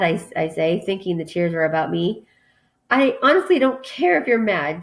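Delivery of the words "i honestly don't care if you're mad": higher-pitched, spiteful retort. A narrator's reading. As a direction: throw it back with mild spite. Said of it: "she says spitefully".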